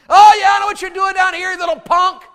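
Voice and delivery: high, loud big-brother taunting; mocking. Angry eldest brother to little David, loud mockery. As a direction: jeer at him, making fun. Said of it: "He's making fun of the sheep".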